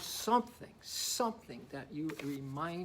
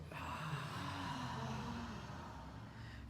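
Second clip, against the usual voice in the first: long audible exhale; big sigh; 7 semitones higher, 8 dB quieter than usual. Shown as a demonstration. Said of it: "wanna hear a big sigh".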